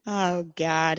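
ordinary talk

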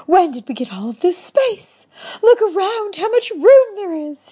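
high, loud delighted exclamations; gleeful. Acted. Wife twirling in delight at the space, high and gleeful. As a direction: bubble over with glee. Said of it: "she chortled with glee".

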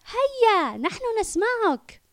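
put-on very high, loud child's shout; little girl shouting. Little girl shouting encouragement to Yusuf on stage. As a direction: cheer him on as a small girl. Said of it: "صرخت طفلة صغيرة".

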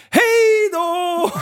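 mock farewell. Telling the critic goodbye.

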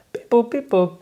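high robotic beep boop; beeping noises. Pretend. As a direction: chirp it like a little machine. Voicing a gadget controlling thermostat.

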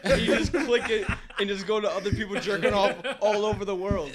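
loud, laughing explanation; amused and loud, barely containing laughter; laughing while talking. Real, not acted.

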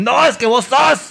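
high, mocking accuser voice. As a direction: mimic someone accusing you. Imitating accusers. (voz burlona de acusador)

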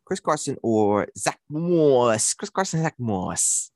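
drawn-out Long Island vowels; Long Island accent. Pretend. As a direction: relish the name in slow Long Island accent. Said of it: "say Zach Moss in your Long Island accent".

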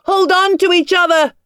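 loud, high desperate yell. A narrator's reading. Tom in his nightmare yelling to his children. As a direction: cry out to the children to hold on. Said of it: "yelling for the children".

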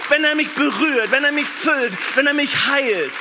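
high, loud fervent preacher cry; preaching. Real, not acted. Preacher urging the church to seek Jesus. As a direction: lift it up, full force.

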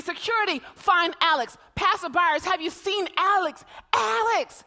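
loud, high, urgent calling. Retold or imitated. Mother reenacting shouting to security and passersby. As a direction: shout for help to anyone nearby. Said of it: "I'm calling security".

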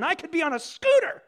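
mock outburst rising on the last word; envious exclamation. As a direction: build to a high, covetous cry. Voices envious rider exclaiming.

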